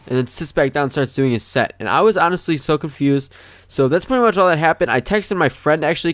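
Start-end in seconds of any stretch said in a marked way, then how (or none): none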